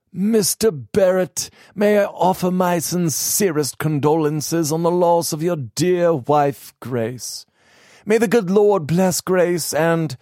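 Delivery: high, unctuous little clergyman; fawning preacher offering condolences; reverend's voice. Acted.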